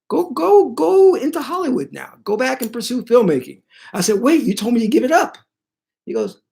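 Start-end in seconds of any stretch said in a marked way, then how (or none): 0.1-3.6 very high-pitched imitation of a spirit; high, insistent mock send-off; urging spirit voice. Spirit voiced urging him onward. 4.2-5.4 high, quick retold objection; mock-baffled, high and rushed; incredulous protest. Acts out protest at reversal.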